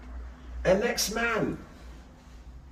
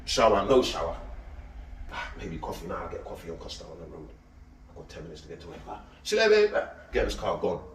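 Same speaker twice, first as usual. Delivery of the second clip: mock lazy worker; 12 semitones lower, faster than usual. Mocking a worker's rushed morning.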